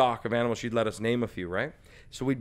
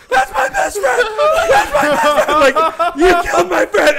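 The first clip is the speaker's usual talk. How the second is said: much higher, much louder, laughing mock wail; mock wailing brother; 14 dB louder, slower than usual. Little brother mourning his lamb Spencer, high laughing mock wail. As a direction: wail it like a heartbroken kid. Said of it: "my brother would be like".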